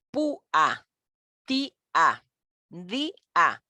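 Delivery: "pua, tia, dia" each in two beats, pú-a, tí-a, dí-a; "pua, tia, dia" broken syllable by syllable; hiatus split apart. Shown as a demonstration. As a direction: stress the first vowel, pause, then a separate "a" in "pua, tia, dia". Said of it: "la separamos de una".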